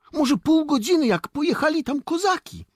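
put-on high, loud boyish news-telling; excited report. Boy warns soldiers about Cossacks, excited. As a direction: blurt out the news, pitch way up.

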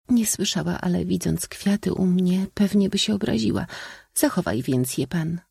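high, feminine voice; princess. A narrator's reading. Princess telling the suitor to keep his flowers, high-voiced. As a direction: explain it high and ladylike.

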